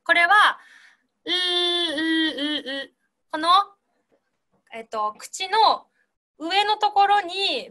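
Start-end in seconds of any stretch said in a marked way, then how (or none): 1.2-2.9 "う" as a long voiced L hum; tongue on the roof of the mouth, sustain "う" as L; L pronunciation. Shown as a demonstration. Teacher demonstrating the L sound, "う" as drawn-out voiced L. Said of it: "Lの発音".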